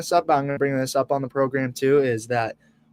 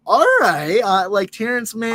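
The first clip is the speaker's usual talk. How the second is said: high, loud burst of excitement at the trade news; hopeful cheer on hearing a name; excited reaction; 9 semitones higher, 5 dB louder than usual. Retold or imitated. Fan reacting to the trade news, excited. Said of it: "I'm like".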